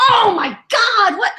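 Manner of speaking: high, drawn-out outburst; mock exasperation. Co-host appalled at her best friend, mock exasperated. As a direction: exclaim in hammed-up disbelief.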